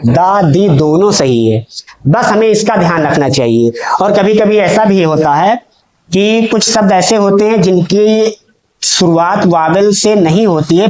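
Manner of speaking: ordinary talk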